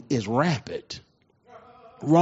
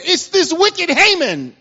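accusing queen; 11 semitones higher, 5 dB louder, slower than usual. Retold or imitated. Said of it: "And she said".

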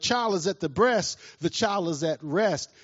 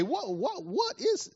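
baffled question; 10 semitones higher, 3 dB quieter, slower than usual. Retold or imitated. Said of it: "I would say".

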